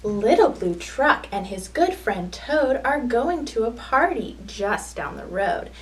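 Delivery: put-on lively sing-song storytelling for small children; picture-book read-aloud. A narrator's reading.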